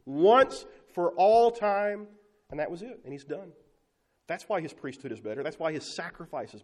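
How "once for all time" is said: "Once for all time" drawn out very slowly, loud and high; stressed phrase. Acted. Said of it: "Did you hear that language?".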